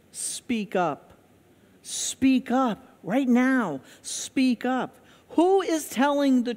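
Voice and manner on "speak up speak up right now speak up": slow, insistent command to speak up; commanding God's voice. Pretend. God urging the timid listener, slow firm command. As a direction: press each word, slow and firm. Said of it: "God's voice is".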